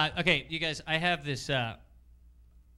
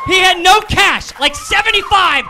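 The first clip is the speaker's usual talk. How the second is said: incredulous shout; 11 semitones higher, 15 dB louder than usual. Real, not acted. Comic shouting the punchline to the audience. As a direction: yell the absurd detail at the crowd.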